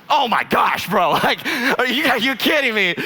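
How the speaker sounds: exasperated disbelief. Retold or imitated. Reacting inwardly to coworker's answer, acted exasperation. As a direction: erupt in comic exasperation.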